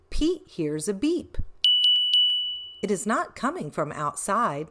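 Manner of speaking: ordinary delivery, nothing marked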